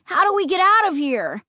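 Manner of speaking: high, complaining question; Luke's wail. A narrator's reading. Stranded boy wails to his family. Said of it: "Luke wailed".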